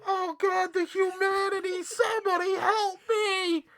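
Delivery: high, crying damsel in distress; terrified horror victim. Acted. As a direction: wail for help like a horror victim. Mock horror cry after door.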